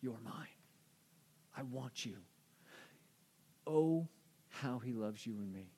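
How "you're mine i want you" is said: softer, hushed voicing of God; whisper. Preacher voicing God speaking softly to his people, hushed. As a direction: breathe it gently, like a secret. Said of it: "God is just whispering".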